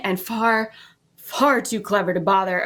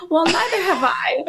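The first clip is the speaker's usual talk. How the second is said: normal talking voice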